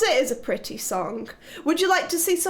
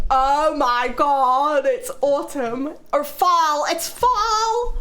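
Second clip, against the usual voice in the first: re-enacted excitement; 3 dB louder, much slower than usual. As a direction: imitate your own giddy delight, stretching each word. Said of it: "I got so excited".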